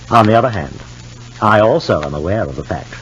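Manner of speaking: normal talking voice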